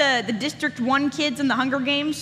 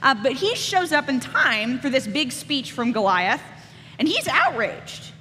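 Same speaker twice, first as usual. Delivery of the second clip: ordinary talk, nothing marked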